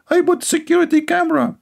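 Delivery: put-on sing-song falsetto girl boasting to her friend; girly falsetto. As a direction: squeak it out like a silly girl answering. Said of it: "like two girls, they are talking".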